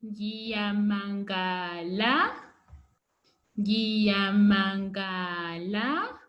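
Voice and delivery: "Ngiyamangala" drawn out slowly with a rising end; slow model word. Shown as a demonstration. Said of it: "Okay, surprise".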